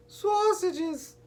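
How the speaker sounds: put-on very high-pitched gleeful toddler whoop. A narrator's reading. Little Treasure asked what he wants for dinner, whooping. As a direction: squeal it out in one long whoop. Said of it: "he whooped".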